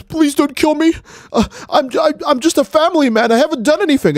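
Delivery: high, panicky mock begging; Gohan pleading. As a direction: stammer and plead like a coward. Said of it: "Gohan falls to his knees".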